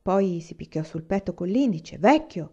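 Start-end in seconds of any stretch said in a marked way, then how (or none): 2.0-2.5 put-on a proud call to the old man, a bit louder; mildly proud, raised voice; a touch of pride, calling out "Vecchio"; proud exclamation. A narrator's reading. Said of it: "esclamò orgogliosamente".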